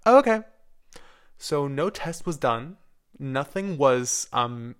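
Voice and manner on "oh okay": high, loud "oh, okay"; surprised mom. Retold or imitated. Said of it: "my mom was like".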